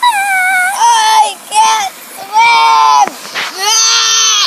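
loud slow drowning cry; panicked shout. Acted. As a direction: yell for help. Doll falls in sea.

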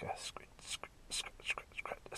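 scratch, scratch whispered like a sound effect. Retold or imitated. Prisoners hearing a lock picked, whispered scratch sounds. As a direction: hush it as if listening at the door.

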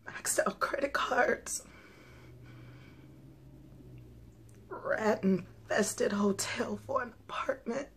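hushed, broken whisper of despair; tragic heroine sorrow. Acted. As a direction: whisper it like everything is lost. Despairing confession after long pause.

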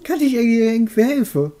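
put-on high, cackling old witch. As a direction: play the friendly old witch. Voices the witch character.

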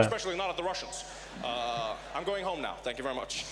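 evasive presidential impression; Barack Obama. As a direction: wrap up hastily like a president dodging blame. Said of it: "Obama was like".

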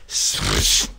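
usual speaking voice

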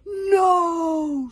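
long, high, loud cry; drawn-out roar of refusal; anguished shout. A narrator's reading. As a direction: shout it out in despair. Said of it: "shouted Stoic".

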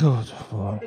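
very low, soft murmur; hushed, deep and subdued; calmed down. Retold or imitated. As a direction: drop right down, suddenly placid. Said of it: "Machete just calmed down straight away".

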